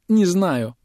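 high, teary little voice; tearful. Acted. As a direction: say it near tears. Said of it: "всплакнула Улада". (сквозь слёзы)

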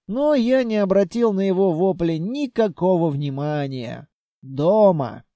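plain reading voice

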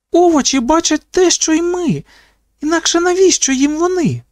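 дуже високий збуджений голос; випали здогад високо й схвильовано; схвильований здогад. A narrator's reading. Схвильований здогад, хапає Ейвері. (високо, збуджено, з натиском)

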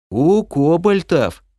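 slow, stretched answer; drawn out. Acted. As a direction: drawl it lazily. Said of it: "протяжно ответил Орбит". (протяжно, нараспев)

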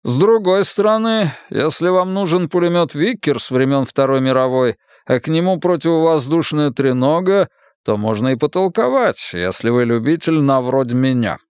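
lightly put-on Irish accent. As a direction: slip into a hint of Irish accent. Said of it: "Он снова заговорил с ирландским акцентом".

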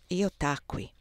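usual speaking voice

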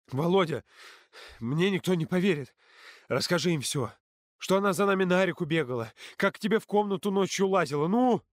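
plead, then demand at rising pitch; insistent begging that climbs to a shout; desperate urging. A narrator's reading.

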